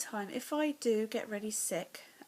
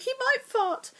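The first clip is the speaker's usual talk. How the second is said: very high, much louder panicked squeal; 11 dB louder, slower than usual. Retold or imitated. To her brother about his dog, a high panicked squeal. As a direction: shriek it like she's terrified of the dog. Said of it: "I was like".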